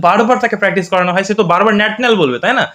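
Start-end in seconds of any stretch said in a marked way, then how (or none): none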